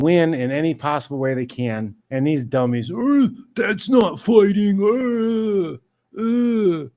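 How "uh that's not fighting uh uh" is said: high, loud, slow dopey grunting voice; dumb caveman mockery. Mocking critics as grunting dummies, loud and slow. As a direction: play the fan as a braying dimwit. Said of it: "That's how you sound like".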